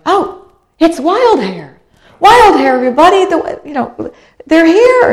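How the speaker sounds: high, loud excited calling out; excited cheering. Retold or imitated. Dinghy crowds hailing the returning ship, shouting with excitement. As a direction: cheer them in like long-lost friends. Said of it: "all these dinghies start going".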